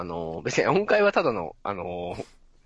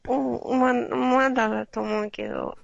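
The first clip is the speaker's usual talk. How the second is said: put-on higher, slow hesitant boy voice; mock shy boy; 8 semitones higher, slower than usual. A shy boy answering an awkward question. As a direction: answer bashfully like a kid.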